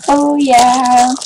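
normal talking voice

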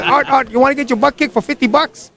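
put-on teasing challenge in a Chinese accent. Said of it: "in his Chinese accent".

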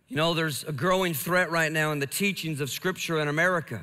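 usual speaking voice

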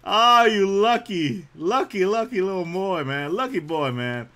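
high, loud, drawn-out exclamation; playful envy. Pretend. Player envying a lucky card pull, playful envy. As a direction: crow it in mock jealousy.

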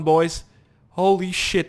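ordinary delivery

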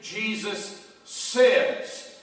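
Jesus drawn out long and slow; slow reverent Jesus says. Shown as a demonstration. Preacher showing the congregation how he prays, slow and reverent. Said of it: "you almost always hear me say".

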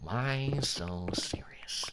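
very slow, hushed villain voice; Heath Ledger. Acted. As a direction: draw it out softly and menacingly. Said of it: "Do the Heath Ledger voice, Batman".

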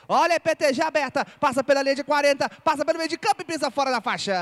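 fast, loud run call; excited play-by-play. Real, not acted. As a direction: rush through the play.

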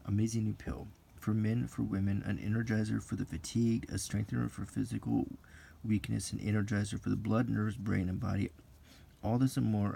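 hyped-up ad announcer voice; ad read. Acted. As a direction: sell it like an old radio ad. Reads pill advertisement aloud.

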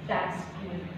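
very soft, low murmur of satisfaction; hushed approval. Acted. God quietly approving his new creation, hushed approval. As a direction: say it softly, almost to yourself.

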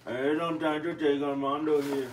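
put-on Mexican Spanish accent, joking; playful Mexican accent imitation; mock Mexican accent. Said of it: "That's how the Mexican people really talk".

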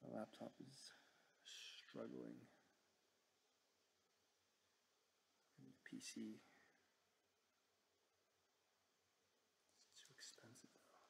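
very soft, fading nearly to a whisper; muttered grumbling. Real, not acted. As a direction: grumble under the breath about the laptop. Grumbling alone at a struggling laptop.

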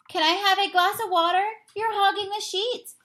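high, fast, pestering plea; voiced pest begging at bedtime; whiny guest voice. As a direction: nag him like a restless houseguest. Animal guests pester Bruce at night.

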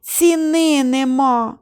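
slow, high, lightly sobbed lament; mock sobbing. A narrator's reading. Soaked hunter sobs over his lost shotgun, lightly hammed. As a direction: wail over the lost gun. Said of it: "проридав".

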